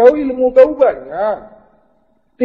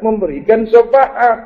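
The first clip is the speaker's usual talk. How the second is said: ordinary talk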